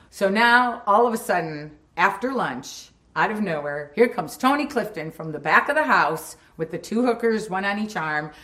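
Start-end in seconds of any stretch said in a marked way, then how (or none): none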